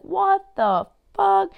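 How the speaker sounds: high, slow, loud stunned voice; imitating her disbelief. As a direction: stretch it out as her, totally floored. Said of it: "she'd be like".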